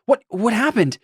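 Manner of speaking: high-pitched, stammered worry; acted shocked concern; alarmed. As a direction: blurt it on finding a friend hurt. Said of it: "rushes over to her and it's like".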